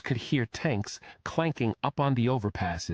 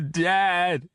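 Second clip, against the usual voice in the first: higher, louder tearful cry; blubbering; 9 semitones higher, 6 dB louder than usual. Acted. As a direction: sob out the word. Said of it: "he blubbered".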